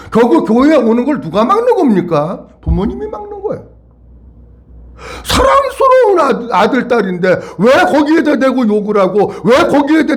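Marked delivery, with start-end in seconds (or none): 5.2-10.2 high-pitched pleading exasperation; impassioned reproach. Real, not acted. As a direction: reproach parents in a raised pitch.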